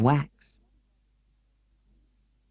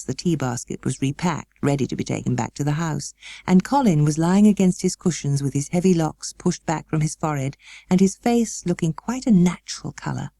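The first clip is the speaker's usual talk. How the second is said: ordinary delivery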